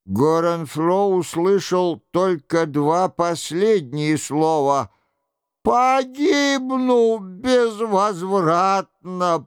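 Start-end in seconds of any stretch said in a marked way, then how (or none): 5.6-9.4 high, slow, pitiful wail; piteous moaning lament; plaintive wail. A narrator's reading. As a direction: draw it out high like a doomed man lamenting. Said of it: "произнёс он жалобным голосом".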